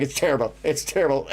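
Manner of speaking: words breaking up into laughter; laughing. Real, not acted. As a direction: say it while fighting real laughter. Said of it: "I'm sorry, as I'm laughing, I'm like".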